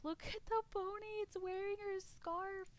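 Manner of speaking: high, slow, weepy voice; imitating bawling. Streamer reliving crying over the pony clip, high slow sob. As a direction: wail it as if tears are streaming. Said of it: "I started bawling my eyes out".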